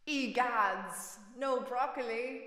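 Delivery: hammed-up posh British accent. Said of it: "my stereotypical, attempts at a British, accent".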